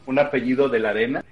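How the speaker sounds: ordinary talk, nothing marked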